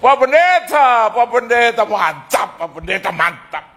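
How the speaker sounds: starts high, loud and slow, then drops to near normal; loud fanboy praise trailing off; eager praise, fading. Retold or imitated. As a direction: gush over the pastor. Ex-collectors praising the pastor.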